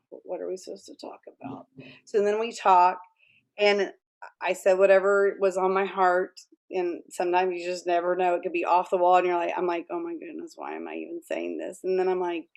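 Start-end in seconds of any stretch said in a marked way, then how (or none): 0.1-1.7 quick whispered question to God; whispered aside to God. Retold or imitated. 9.9-11.8 hushed self-doubt. Retold or imitated. Inner doubt while talking.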